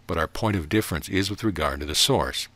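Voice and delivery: ordinary delivery, nothing marked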